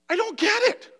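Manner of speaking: high, pleading disbelief; a high cry of not understanding; bewildered plea. Real, not acted. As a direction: ask it like it truly baffles you. Emotional preacher, bewildered plea.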